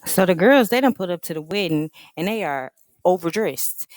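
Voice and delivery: ordinary delivery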